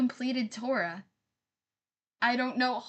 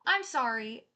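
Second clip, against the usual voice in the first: usual speaking voice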